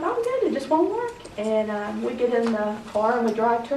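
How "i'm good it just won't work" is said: much higher, carefree and silly; goofy cheerful. Retold or imitated. Telling her sister she's fine, high and goofy. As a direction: brush it off happily like a goof. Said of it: "I'm like this goofy person".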